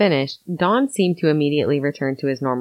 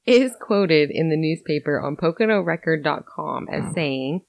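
ordinary talk, nothing marked

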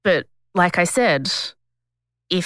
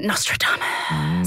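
slow mysterious whisper; 13 semitones lower, slower than usual. Acted. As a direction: hush the name slowly.

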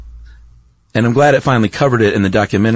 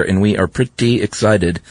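ordinary talk, nothing marked

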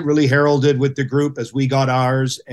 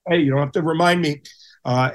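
ordinary talk

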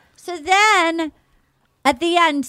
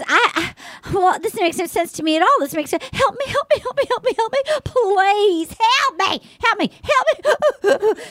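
mock crying plea; 8 semitones higher than usual. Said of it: "she goes".

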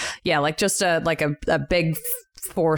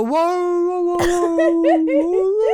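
high, louder, laughing whoa-whoa of a startled dog; confused dog; 10 semitones higher, 4 dB louder, much slower than usual. Retold or imitated. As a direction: play the dog, baffled and yelping. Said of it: "the dog go".

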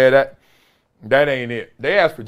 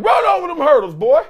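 mock coach yell; 12 semitones higher, 4 dB louder than usual. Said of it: "Coach, you be like".

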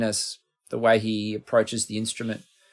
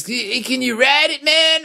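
hammed-up Brooklyn accent, much higher and pushed up on man; a badly faked Brooklyn drawl, pitched way up; 13 semitones higher, 5 dB louder than usual. Imitating [name] asking about the amp, bad Brooklyn accent. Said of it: "I'm doing a really bad Brooklyn accent".

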